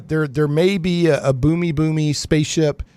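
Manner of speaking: ordinary talk, nothing marked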